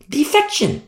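very high emphatic repeat. Real, not acted. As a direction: leap up on "Defection". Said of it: "emphatic repetition".

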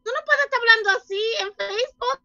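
high, quick mock scolding in Spanish; lightly voiced mother scolding her online; mimicking scolding mother. Son quoting his mother scolding him about Facebook, mock scolding.

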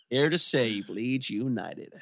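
slow, lightly put-on American coach accent; a half-hearted American soccer coach; shitty American accent. Host mocking Leeds' new American manager in an American accent. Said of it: "a shitty American accent that I now regret".

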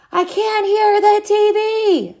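high, slow, stretched holler. A narrator's reading. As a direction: holler in frustration. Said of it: "Ty hollered".

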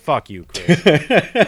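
mock outburst. To his co-host Chris, a slow playful mock yell. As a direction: yell it slowly at your friend in fun.

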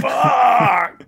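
loud, high, drawn-out curse; frustrated shout. Real, not acted. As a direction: yell it out in exasperation. Player reacts to crushing damage.

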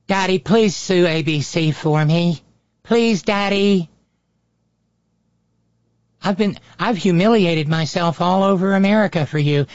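high, pleading daddy's-girl mockery; Nancy Mace. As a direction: beg Daddy in a hammed-up whine. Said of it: "that's Nancy Mace in all her glory".

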